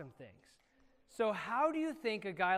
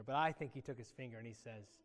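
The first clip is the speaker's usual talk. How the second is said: normal talking voice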